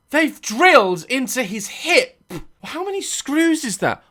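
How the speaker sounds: high, stretched-out horror; horrified counting the screws; appalled. Real, not acted. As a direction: gasp it out. Appalled commentary.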